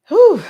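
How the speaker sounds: loud, high exhale fighting back tears; emotional whew. Real, not acted. Overcome when her aunt shows up, a shaky whew. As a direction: blow out the feeling before it takes over. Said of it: "I'm not gonna get emotional".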